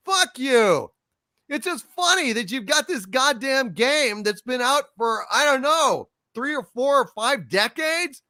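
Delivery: high, emphatic indignation; exasperated, defiant outburst; angry rant. Real, not acted. Angry rant at game publishers.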